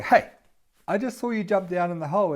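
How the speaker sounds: acted incredulous objection; indignant protest. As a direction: call them out on what you just saw. Acted protest at deliberate jump.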